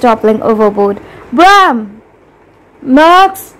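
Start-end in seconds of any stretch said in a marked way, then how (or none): 1.3-2.0 narrator's yelled name, falling at the end; moderately raised calling voice. A narrator's reading. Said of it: "he yelled". 2.8-3.5 high calling cry of a name; raised, urgent cry. A narrator's reading. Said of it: "cried Bram".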